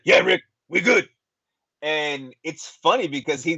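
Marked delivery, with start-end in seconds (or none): 0.0-1.1 higher, light rapper impression. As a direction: imitate the rapper casually. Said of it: "he literally sounds like that person".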